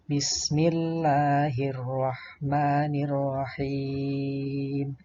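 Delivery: slow, sustained recitation with long held vowels; Qur'an recitation. Shown as a demonstration. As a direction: recite it slowly as a model for pupils. Mengulang bacaan dari awal. (tilawah pelan dengan bacaan panjang)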